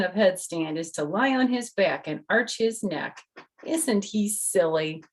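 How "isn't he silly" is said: ordinary delivery, nothing marked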